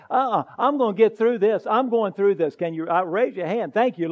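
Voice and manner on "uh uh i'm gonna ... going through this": louder, determined "Uh-uh"; loud defiant vow. Shown as a demonstration. Preacher voicing a believer's defiant resolve, loud. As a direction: refuse to back down, out loud. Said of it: "finally had to say".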